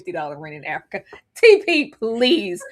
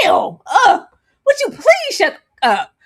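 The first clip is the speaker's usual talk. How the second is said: much higher, much louder ugh, ew; disgusted shriek; 11 semitones higher, 8 dB louder than usual. Retold or imitated. Woman screaming at the TV in disgust. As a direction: scream at him to shut up. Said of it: "I actively screamed".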